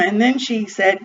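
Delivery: usual speaking voice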